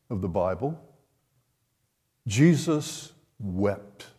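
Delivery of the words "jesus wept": each word slow and weighty; Jesus wept, slow and grave; slow solemn reading. Shown as a demonstration. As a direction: let the verse sink in slowly. Repeats the verse for weight.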